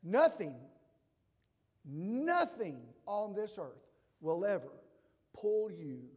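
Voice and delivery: loud, impassioned pulpit cry; preaching at full force. Acted. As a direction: give it everything, all in. Preaching God's promise.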